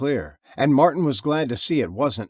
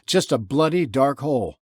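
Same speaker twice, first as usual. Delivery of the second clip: ordinary delivery, nothing marked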